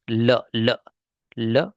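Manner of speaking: ल said alone, a clear l with a short vowel; isolated l sound. Shown as a demonstration. Teacher isolates the ल sound inside the word. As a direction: tap the tongue for ल. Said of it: "ल sound में आ रहा है".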